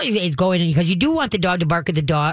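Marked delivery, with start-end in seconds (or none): none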